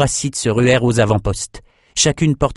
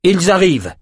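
high-pitched urgent warning; acted lookout's cry; 8 semitones higher, 3 dB louder than usual. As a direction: call out that danger is coming. Warning of approaching enemies.

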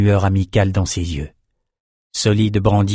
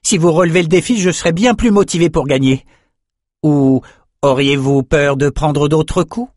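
raised, higher-pitched challenge; taunting cry; 6 semitones higher, 4 dB louder than usual. A narrator's reading. Young swordsman daring the Mauracite to fight, calling out. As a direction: goad the opponent with a called-out dare. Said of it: "cria-t-il à la Mauracite".

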